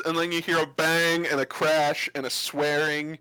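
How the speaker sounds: usual speaking voice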